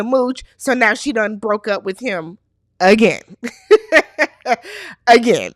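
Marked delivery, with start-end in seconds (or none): 3.4-5.0 high, tickled cackle; amused laugh. Real, not acted. Laughs at the repeated breakup.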